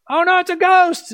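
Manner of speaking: high, loud frightened cry; mock fright. Disciples in the boat seeing a ghost: high, loud mock fright. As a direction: squeal it like a scared disciple. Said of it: "they're freaking out".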